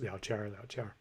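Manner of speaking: 聊天儿 with an R-coloured final "tiar"; erhua ending. Shown as a demonstration. Pointing out his habit, 聊天儿 with erhua R. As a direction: curl the end of 聊天儿 into an R. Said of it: "he just starts".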